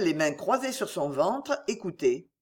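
plain reading voice

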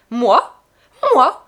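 loud, bitter vehement retort; indignant, vehement. A narrator's reading. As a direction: hurl it at him in indignation. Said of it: "indignée et véhémente".

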